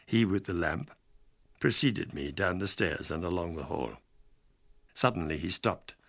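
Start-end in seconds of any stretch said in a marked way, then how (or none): none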